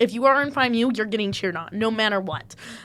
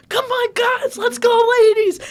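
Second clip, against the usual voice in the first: high, loud rallying yell; sideline cheer; 12 semitones higher, 7 dB louder, slower than usual. Retold or imitated. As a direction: imitate hyping up the team from the sidelines. Retold sideline cheering while jumping.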